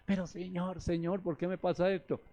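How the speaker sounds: ordinary talk, nothing marked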